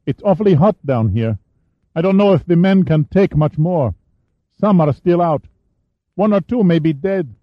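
put-on a teenage boy reporting up; adolescent voice. A narrator's reading. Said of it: "The voice had an adolescent pitch".

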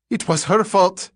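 high panicked plea; desperate cry. A narrator's reading. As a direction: cry out in terror. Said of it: "he cried".

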